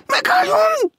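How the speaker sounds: very high, loud shriek; almost scream it in alarm; near scream. A narrator's reading. Maud as the stranger makes for the window, almost screaming. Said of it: "Maud szinte felsikoltott".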